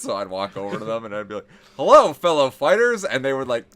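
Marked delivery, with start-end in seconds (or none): 1.8-3.0 high, loud, drawn-out mock greeting; hearty greeting. Character walking up to tavern half orcs, hammed-up hearty greeting. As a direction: hail them slowly and loudly, playing the character.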